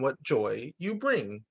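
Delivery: normal talking voice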